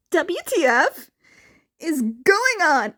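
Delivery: loud confused outburst; exasperated yell. Acted. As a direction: yell at the nonsense. Baffled chat loudmouth.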